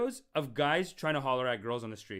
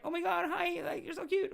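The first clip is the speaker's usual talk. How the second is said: very high, breathy-free girly gush, mocking; imitating a girl; 14 semitones higher than usual. Mocking a smitten girl gushing at the pickup guy, high girly voice. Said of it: "Girl's like".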